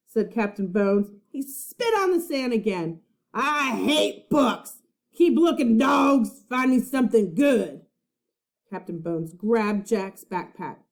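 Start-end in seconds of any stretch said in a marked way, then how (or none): 3.3-7.9 pirate snarl. A narrator's reading. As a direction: snarl orders at the crew. Captain snarling at crew.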